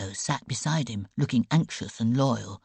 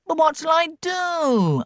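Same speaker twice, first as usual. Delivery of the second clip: much higher, louder plaintive wail; 7 dB louder than usual. A narrator's reading. Sick boy wails as girl carries bowl away, high plaintive. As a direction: wail helplessly. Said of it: "Ivor was wailing".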